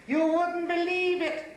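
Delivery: high, drawn-out cry of disbelief; exclaiming. Acted. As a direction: exclaim it high-pitched and slow. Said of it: "he exclaimed".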